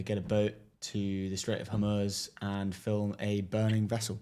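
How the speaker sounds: ordinary talk, nothing marked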